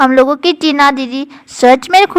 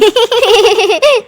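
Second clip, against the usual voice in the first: high laugh; 8 semitones higher than usual. Acted. Laughs off her own glasses.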